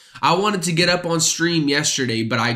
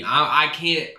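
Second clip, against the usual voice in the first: ordinary talk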